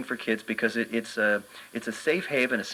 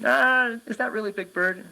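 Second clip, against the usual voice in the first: put-on much higher, doubtful child voice; a little kid who doesn't buy it; skeptical kid; 12 semitones higher, 3 dB louder than usual. A small boy doubts the old man is Big Bird, high skeptical child voice. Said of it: "The kid said skeptically".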